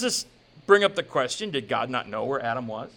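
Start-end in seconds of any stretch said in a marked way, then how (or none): none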